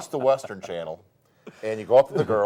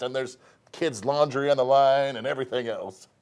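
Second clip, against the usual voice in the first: normal talking voice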